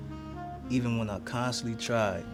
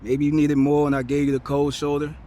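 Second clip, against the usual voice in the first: raised voice, higher and louder; impassioned confession; 4 semitones higher, 7 dB louder than usual. Acted. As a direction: own up to your failings with rising force. Apology poem building to confession.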